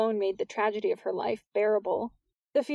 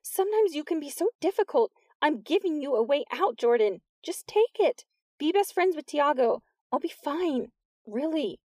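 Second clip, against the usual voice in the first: exasperated; 6 semitones higher than usual. A narrator's reading. Exasperated pleading with Jordan.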